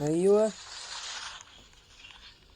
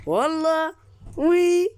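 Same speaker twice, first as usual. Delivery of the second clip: high, loud cheerful goodbye; youtuber's loud outro goodbye; loud sign-off; 10 semitones higher, 4 dB louder than usual. Real, not acted. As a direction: shout out the farewell.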